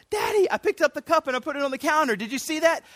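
put-on high, quick, proud little child; three-year-old. Toddler telling daddy about the cup, proudly. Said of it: "my little three-year-old does that".

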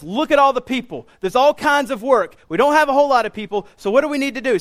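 high, loud rallying call; getting them excited. Acted. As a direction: rouse the team like an eager organizer. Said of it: "he's getting the disciples excited".

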